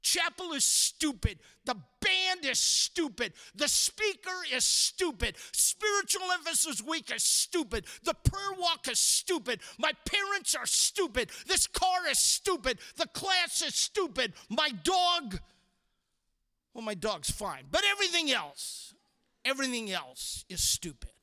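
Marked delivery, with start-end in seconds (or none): 0.0-15.4 high, loud mock tirade; hammed-up, high-pitched griping; bad-attitude rant. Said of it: "that leads to a bad attitude". 17.7-19.0 high, petulant mock outburst; hammed-up exasperation, high and forceful; exasperated outburst. Acted bad-attitude rant resumes. 19.5-21.1 dropped, sulky muttered verdict; acted sulk, voice falling away; sullen grumble. Rant drops to sullen repeat.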